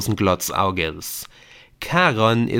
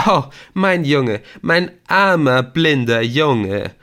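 high, loud, drawn-out theatrical lament; 5 semitones higher, 6 dB louder, slower than usual. Acted. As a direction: overplay the pity, higher and louder, slow on the last words. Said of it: "rief Viktor theatralisch". (theatralisch klagend, hoch, laut und gedehnt)

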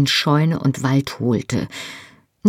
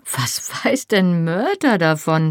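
higher, slow, drawn-out indignation; indignant; 4 semitones higher than usual. A narrator's reading. Woman disputing a friend's advice to her lover, indignant. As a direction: object with indignant disbelief, stretching the question. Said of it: "sagte ich entrüstet".

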